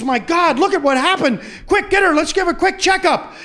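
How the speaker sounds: high, loud and urgent; astonished doctor. Acted. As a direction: call out orders in amazed excitement. Doctor astonished at sudden recovery.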